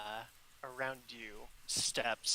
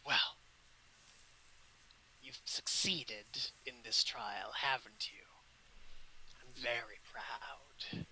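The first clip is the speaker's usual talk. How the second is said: goddess voice. Acted. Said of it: "and she says".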